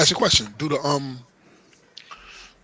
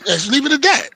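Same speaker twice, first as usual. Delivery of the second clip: laughing; 9 semitones higher than usual. Real, not acted.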